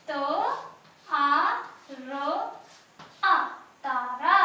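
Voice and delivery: त, आ, र, आ one by one, then तारा loud; sounding out letters. Shown as a demonstration. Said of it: "write down here". (तारा को ध्वनियों में तोड़ना)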